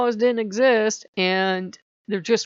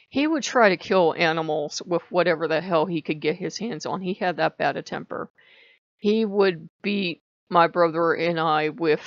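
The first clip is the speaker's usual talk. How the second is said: ordinary talk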